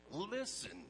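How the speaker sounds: "Listen" called out high; preacher urging the church to listen, emphatic. Shown as a demonstration. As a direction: open the verse with a strong "Listen". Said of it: "emotional emphasis on these verses".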